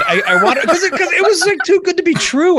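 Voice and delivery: high, loud, laughing protest; amused disbelief. Real, not acted. Host admitting he asked about the voice, laughing and high-pitched. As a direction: protest through laughter, voice shooting way up. Laughing defence of asking the guest.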